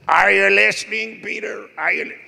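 high, loud put-on preacher voice; Morris Cerullo impression. Preacher friend checks Peter is listening, loud imitation. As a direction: imitate a booming preacher demanding attention. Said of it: "He had a voice like".

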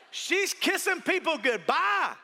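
normal talking voice

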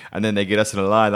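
usual speaking voice

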